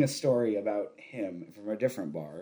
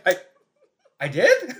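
high, loud laughing disbelief; surprised; 7 semitones higher, 8 dB louder than usual. Retold or imitated. Learning his habit, laughing surprised disbelief. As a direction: laugh out your shock. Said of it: "And I was like".

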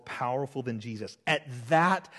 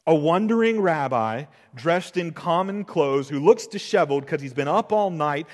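normal talking voice

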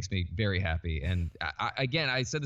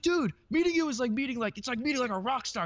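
high-pitched imitation of a starstruck fan; Kevin Smith; 14 semitones higher than usual. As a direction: gush in awe, pitched way up. Kevin Smith gushing, retold.

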